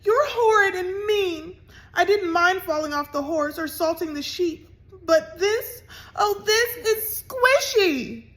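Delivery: high, loud tearful wail; crying. A narrator's reading. Annabelle accusing the pranksters, crying loudly. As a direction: sob it out, wounded. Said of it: "she sobbed".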